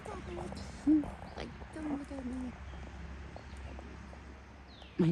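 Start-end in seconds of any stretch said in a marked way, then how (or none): 0.0-2.5 much higher, softer squeaky voice for the little dog. Pretend. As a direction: voice the tiny dog telling the big one off, high and soft. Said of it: "The little dog is probably like".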